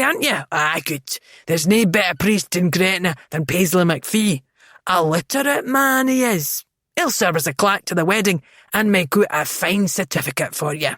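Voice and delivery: cheerful Scottish burr; obliging Scots innkeeper voice. A narrator's reading. Innkeeper answering the lord, Scottish accent.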